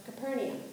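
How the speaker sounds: Capernaum said as "kuh-PER-nee-um"; Capernaum in four syllables, kuh-PER-nee-um; alternative pronunciation. Shown as a demonstration. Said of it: "depending on how you say it".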